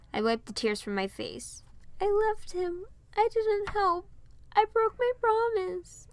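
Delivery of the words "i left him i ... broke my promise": very high, slow, small sad voice; tearful child. A narrator's reading. Piper confesses she abandoned Varian, in a high tearful child voice. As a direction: confess through tears.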